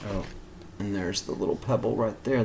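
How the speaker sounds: usual speaking voice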